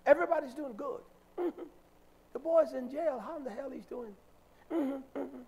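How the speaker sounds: high-pitched mock agreeable sister; sister's way of talking. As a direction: imitate her breezy chatter studded with mm-hmms. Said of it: "That's how she talks".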